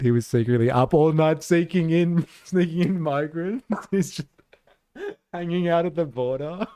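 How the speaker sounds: giggling through the words; laughing while talking. Real, not acted. Streamer to chat, laughing at the Biden joke.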